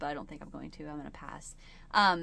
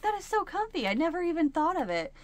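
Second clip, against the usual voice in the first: much higher, a gushing quote; delighted surprise; 10 semitones higher than usual. Retold or imitated. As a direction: squeal that it's so comfy. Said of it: "she's like".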